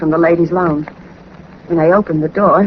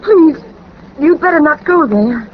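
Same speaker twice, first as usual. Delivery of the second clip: higher, urgent frightened plea; frightened pleading; 6 semitones higher than usual. Acted. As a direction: beg him not to go, scared. Frightened woman begs him to stay.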